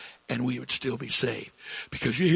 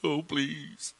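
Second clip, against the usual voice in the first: drawn-out plea dropping softer; acted begging; 7 dB quieter than usual. Preacher imagining Jesus praying to God, mock begging. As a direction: plead slowly, fading on the last words. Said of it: "begging God".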